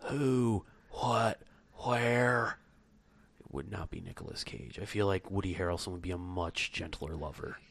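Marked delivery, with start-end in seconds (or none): 3.4-7.6 low, husky radio host voice; sultry Casey Kasem. Acted. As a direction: purr it like a late-night DJ. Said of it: "like a sultry Casey Kasem".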